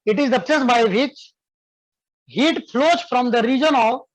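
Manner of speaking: slow, clear dictation for students to write. Shown as a demonstration. Teacher dictating a definition to students, slow and clear. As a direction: spell it out slowly so they can copy. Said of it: "लिखो बाबू".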